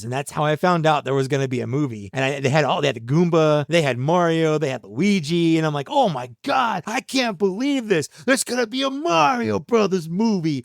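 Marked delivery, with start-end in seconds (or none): 5.9-10.6 excited kid in a faux Italian New York accent. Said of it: "this weird faux Italian New York accent".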